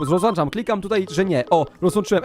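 normal talking voice

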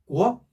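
"꾸어" rising in pitch; second tone. Shown as a demonstration. Teacher answering for second tone, "꾸어" rising. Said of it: "이 성이니까 뭐죠".

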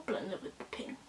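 soft whispered self-instruction; muttering to herself. Real, not acted. Vlogger talking herself through blending, soft whisper. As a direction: whisper it to yourself while working.

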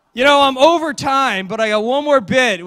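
ordinary talk, nothing marked

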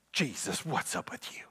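hushed, scoffing whisper; a sneering aside under the breath; muttered whisper. Retold or imitated. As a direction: whisper it behind his back like a grumbling leader. Leaders voiced grumbling at Jesus.

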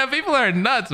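laughing through the words; amused disbelief with a laugh. Real, not acted. Mocking a creator's claim to viewers, laughing.

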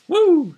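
loud, very high woo; high whoop. Acted. Host cheering the Sens win, high whoop.

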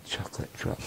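breathy whispered echo of the taunt; whispered insult. Retold or imitated. Recalling the schoolyard taunt, a soft whisper. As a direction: murmur it under your breath.

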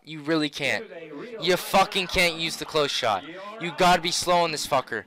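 ordinary delivery

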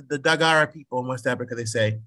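ordinary talk, nothing marked

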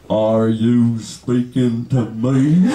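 very low, slow challenge; mock deep-voiced confrontation; imitated coworker. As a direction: draw it out deep and incredulous.

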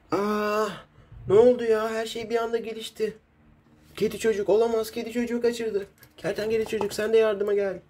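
put-on high, excited child hero; high kid hero voice. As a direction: voice a young superhero in alarm.

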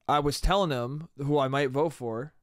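ordinary talk

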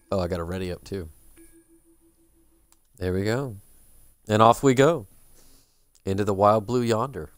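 usual speaking voice

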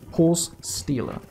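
normal talking voice

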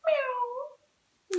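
high, soft kitty mew; cat meow. Shown as a demonstration. Teacher answering what sound a cat makes, high meow. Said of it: "What sound does a cat make?".

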